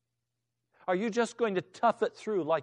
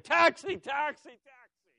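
frantic hailing; 8 semitones higher than usual. Acted. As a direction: yell for a cab, jumping up and down. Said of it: "jumping up and down and saying".

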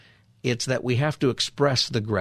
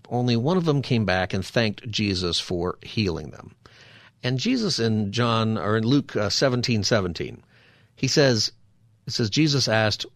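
ordinary talk, nothing marked

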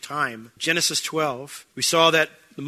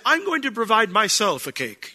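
stilted odd phrasing; 6 semitones higher than usual. Retold or imitated. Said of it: "I wouldn't come and say".

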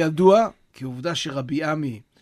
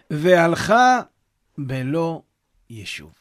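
reading the source text aloud; 2 semitones higher, 6 dB louder, slower than usual. Acted. Reads the source story aloud.

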